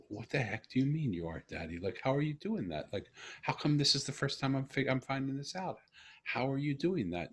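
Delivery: retold disbelief, pitched high and insistent; astonished questioning. Father to daughter who suddenly makes sense, astonished. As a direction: act out being baffled by a sudden discovery.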